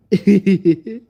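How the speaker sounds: high, loud giggle; laugh. Real, not acted. Storyteller amused by the retold squabble, laughing.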